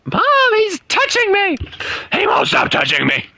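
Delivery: put-on high whiny tattling kid; whiny tattling little sibling. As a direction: whine to mom like a little kid. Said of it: "I'm gonna be like that, that one kid".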